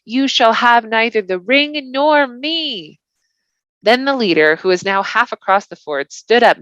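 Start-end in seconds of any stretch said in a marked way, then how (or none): 0.0-3.0 slow, higher, strained vow; a spent hobbit refusing the riders, slow and strained; last effort defiance. Acted. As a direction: force out a final refusal. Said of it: "said Frodo with a last effort".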